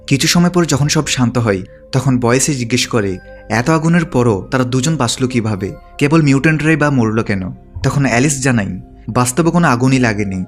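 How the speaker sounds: ordinary delivery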